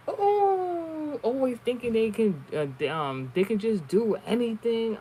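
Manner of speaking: hammed-up imitation of an angry rider; imitating rude woman. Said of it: "She was like".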